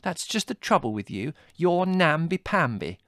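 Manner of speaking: high, loud, contemptuous jibe; scornful snort. A narrator's reading. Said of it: "snorted Margot".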